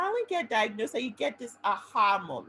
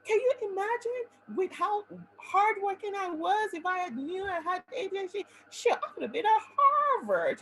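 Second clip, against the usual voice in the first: much higher, louder, fast ranting; venting anger at the late diagnosis, much higher and louder; angry rant; 12 semitones higher, 5 dB louder than usual. Retold or imitated. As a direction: rant at what you missed. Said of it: "You get angry".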